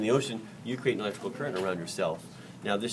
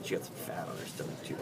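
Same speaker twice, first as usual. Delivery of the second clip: whisper; 3 semitones lower, 9 dB quieter than usual. Real, not acted. Quiet aside over the shark.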